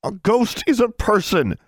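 high, tense, angry insistence; through clenched teeth. A narrator's reading. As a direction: force it out with jaw clenched. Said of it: "said through clenched teeth".

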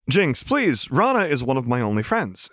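beg, voice starting high and easing down; earnest, anxious plea; pleading. A narrator's reading. Chuck pleads for Rana.

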